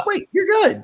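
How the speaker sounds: surprised. Real, not acted. Sudden realisation.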